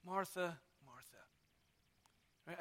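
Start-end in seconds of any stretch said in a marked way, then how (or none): 0.0-1.2 soft, fading tender call; gentle. Shown as a demonstration. Preacher showing how Jesus says her name, soft and tender. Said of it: "it's so gentle".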